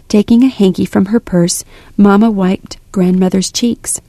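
ordinary delivery, nothing marked

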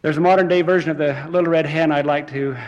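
normal talking voice

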